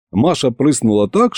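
ordinary delivery

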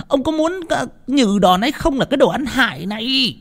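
shrill high scolding wife, rising to a yell. Acted. Wife berating servant Toán, climbing high scolding voice.

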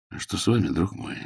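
soft, caring question; sympathetic. Acted. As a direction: gently ask what is wrong. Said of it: "спросил он участливым тоном".

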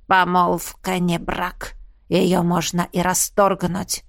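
slow, low sinister hiss. Acted. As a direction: hiss it like a cold threat. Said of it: "прошипел незнакомец".

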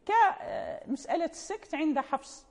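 normal talking voice

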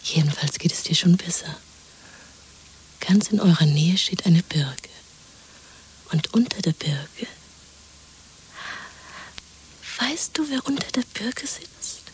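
hushed, breathy secret whisper. Acted. Woman soothing her lover in hiding: soft whisper. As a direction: whisper so no one can overhear.

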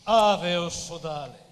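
booming hail; loud solemn Ave; solemn loud greeting. Acted. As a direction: call out like a Roman ghost. Ghost greets Pessoa in dream.

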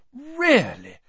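high, loud lofty scoff. A narrator's reading. Hermione scoffing at the girls squabbling over lipstick. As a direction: look down on the silly girls. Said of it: "Hermione said loftily".

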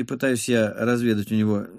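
ordinary delivery, nothing marked

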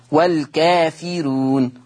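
والكافرون slowly drawn out with a light ra; thinned ra. Shown as a demonstration. Teacher shows students the tarqiq reading, light thinned ra. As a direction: say the ra of والكافرون thin, stretching the long vowels. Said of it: "مرققة، فتصير والكافرون".

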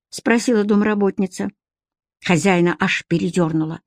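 usual speaking voice

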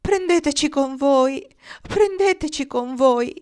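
high, loud plea, slowing on the repeat; pleading call. A narrator's reading. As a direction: beg to be carried away. Patients beg to be taken along.

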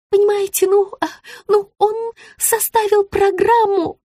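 high-pitched, faltering explanation; hesitant hemming. A narrator's reading. Rita reluctantly admits the program to her client, hemming. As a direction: stall and stammer in a high voice. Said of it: "не мямлите".

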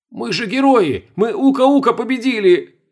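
high, slow panicky outburst; freaking out, voice way up; hysterical. Acted. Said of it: "продолжал психовать Сергей".

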